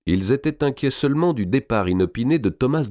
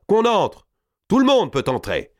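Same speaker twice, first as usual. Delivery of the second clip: high, raised welcome to all; calling people in; 12 semitones higher, 4 dB louder than usual. A narrator's reading. Killers open the death room to townsfolk, calling out. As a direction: call out to the whole crowd. Murderers call townsfolk inside.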